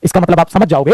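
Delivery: normal talking voice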